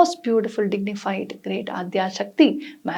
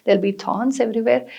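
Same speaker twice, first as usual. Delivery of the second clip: usual speaking voice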